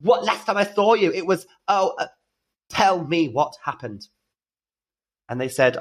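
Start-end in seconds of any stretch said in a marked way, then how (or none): 0.0-4.1 high, breathless, tumbling greeting; excited greeting. Retold or imitated. Drag queen greeting the teen again, high and gushing. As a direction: gush at the reunion. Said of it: "And I said".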